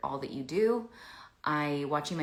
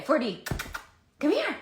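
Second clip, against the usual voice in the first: high, loud sing-song call to the dog; 12 semitones higher, 7 dB louder than usual. Real, not acted. Calling her dog Ford over in high baby talk.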